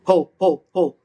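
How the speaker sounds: loud huffed ho ho without tongue; breath attacks. Shown as a demonstration. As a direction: push each ho from the belly, no tongue. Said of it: "removing the tongue from the equation".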